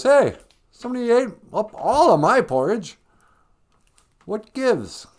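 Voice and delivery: high, loud cub's complaint; whining Baby Bear. Acted. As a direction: play an outraged little bear. Baby Bear's complaint acted out.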